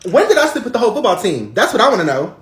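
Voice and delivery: furious high-pitched screaming at Tamika; shrill quarrel. Acted. Angry daughter screaming at Tamika during the fight. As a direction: scream it at her, losing control.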